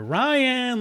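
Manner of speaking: high, loud, urgent call of a name. Retold or imitated. Unseen voice calling his name urgently, loud high call. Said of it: "I clearly hear my name being yelled".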